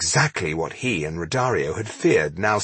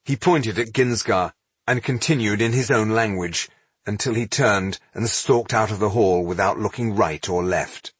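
ordinary delivery

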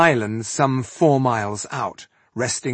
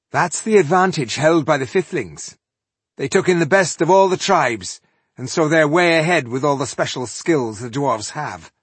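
high, louder, understated admiration; generous praise; 6 semitones higher, 5 dB louder than usual. A narrator's reading. Dwarf crediting another tribe's skills, generously. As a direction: give credit freely in a lifted voice. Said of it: "Slynn said generously".

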